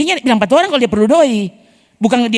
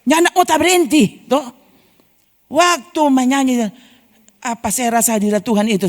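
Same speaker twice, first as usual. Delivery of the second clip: usual speaking voice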